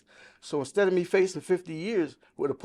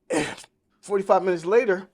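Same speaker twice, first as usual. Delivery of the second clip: louder, weighty build-up before the blow; emphatic pause line; 4 semitones higher, 6 dB louder than usual. Real, not acted. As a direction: land it hard to set up the bad news. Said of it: "repeats it after a sigh".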